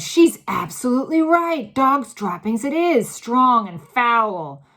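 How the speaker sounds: high, loud, slowing on strong and foul; loud disgust. A narrator's reading. Witch confirming the stench to all, loud disgust. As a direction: relish the disgust.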